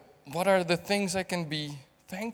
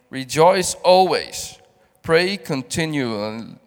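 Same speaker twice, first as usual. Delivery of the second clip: scripture reading; 7 dB louder than usual. Acted. Reads scripture aloud.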